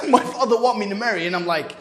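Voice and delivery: ordinary delivery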